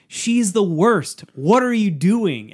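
high, drawn-out mock outrage; imitated cousin losing it. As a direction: stretch each word in exasperated mimicry. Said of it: "he starts losing his mind".

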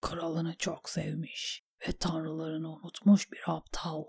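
very soft, whispery self-reproach; inner thought. A narrator's reading. Davos thinks himself a fool, in a hushed voice. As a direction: murmur it privately to yourself. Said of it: "diye düşündü Davos".

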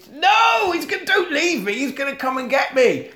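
very high panicky begging; high hammed-up panic; mock terrified child. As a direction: plead like a scared kid. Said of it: "And he's".

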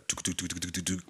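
soft, quiet "tuk tuk tuk" flapping sound; imitated wind noise. Describing freefall noise to friend, soft imitated rattle. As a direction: mimic the wind rattling softly. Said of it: "you hear all this noise".

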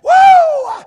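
loud, high "woo"; long high shout of praise; exultant whoop. Real, not acted. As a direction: let out a big revival whoop. Preacher whoops at sermon's peak.

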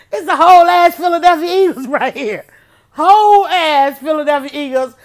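high, loud laughing exclamation; cracking up in disbelief. Real, not acted. Telling viewers the whole Eagles team is beside her, laughing.

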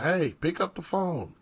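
lightly voiced mom calling from upstairs; imitating mom yelling. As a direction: call down like mom, half-hearted. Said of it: "my mom yells downstairs".

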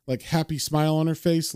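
ordinary talk, nothing marked

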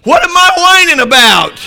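very high, very loud outburst; triumphant shout. Retold or imitated. Preacher retelling his breakthrough in prayer, exultant shout. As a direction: yell it out as the climax. Climax of his retold confession.

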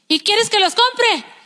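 high, slow, indignant mock question; imitating irritated husband. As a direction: play a husband raising his pitch in protest. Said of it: "alzaba mucho la voz el hombre". (marido indignado, imitado)

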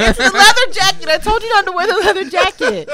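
mock scolding. Mock scolding, high put-on voice.

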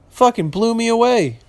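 ordinary delivery

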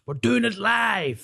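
high, drawn-out mock holler; mock outburst. Podcaster hamming up going live without notes, mock outburst. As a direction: put on a high, stretched cry without real volume.

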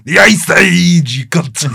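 a hint of Arnie as Mr Freeze; mock Mr Freeze. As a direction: ham up the villain pun. Batman quote, put-on voice.

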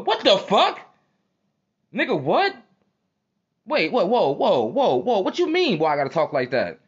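high-pitched, incredulous outburst; acted disbelief. Scotty answering his two-faced homie, acted indignant disbelief. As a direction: react as if thrown by the accusation.

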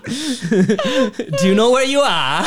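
slow, high, loud mock Cockney; bad Cockney accent. Showing friends an English accent, laughing mock Cockney. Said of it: "that's like the Cockney accent".

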